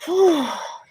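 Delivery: high relieved sigh; sigh of relief. A narrator's reading. Jack out of the hole, a high sigh of relief. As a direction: let out the relief. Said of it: "heaved a sigh of relief".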